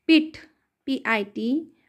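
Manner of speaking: usual speaking voice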